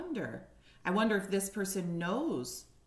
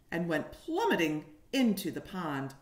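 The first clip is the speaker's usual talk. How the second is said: put-on lively storybook reading for little kids; reading to children; 4 dB louder than usual. A narrator's reading. Teacher reading a picture book to kindergartners, animated. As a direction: paint each moment of the story for the class.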